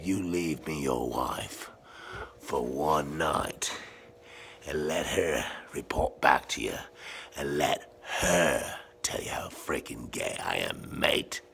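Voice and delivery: low, soft, drawn-out mock kindness; very kindly. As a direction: lay out the offer slowly and calmly. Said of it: "I tell him very kindly".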